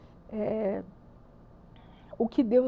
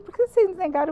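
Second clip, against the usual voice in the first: indignant question; 9 semitones higher, 6 dB louder, faster than usual. Retold or imitated. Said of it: "Eu falei".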